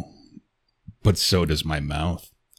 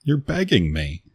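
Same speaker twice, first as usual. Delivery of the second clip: usual speaking voice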